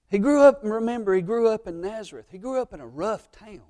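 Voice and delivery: high, loud preaching peak; preaching at full force. Acted. Pastor proclaiming to his church about Nazareth, loud and high. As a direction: build it up and proclaim it.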